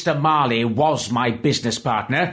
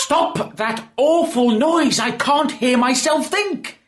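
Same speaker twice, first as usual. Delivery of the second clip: angry outburst; 12 semitones higher than usual. Acted. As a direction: shout the carolers down. Scrooge furious at carolers.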